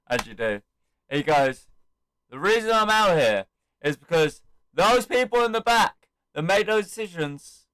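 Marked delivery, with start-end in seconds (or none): 2.3-7.7 raised, projected mic speech; re-enacted promo with punchy phrases; imitated wrestling promo. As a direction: declare it to the whole arena. Acts out Cena's promo.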